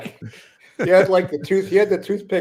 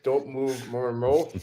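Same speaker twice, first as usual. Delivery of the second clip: ventriloquist voice; 5 dB quieter than usual. Retold or imitated. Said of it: "talk like a ventriloquist".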